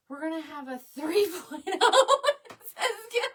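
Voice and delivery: high, loud, dissolving into giggles; amused, breaking into real laughter; laughing. Real, not acted. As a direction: read it out while cracking up. Laughing at a viewer's joke.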